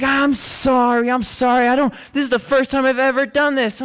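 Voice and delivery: high, pleading excuse; imitated whiny apology. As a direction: drag out the sorry, pleading. Intruder's pleading apology acted out.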